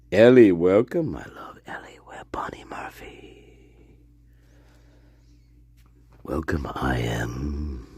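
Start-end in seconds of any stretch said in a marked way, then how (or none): 1.1-7.9 spooky whisper. Pretend. Spooky psychic intro bit.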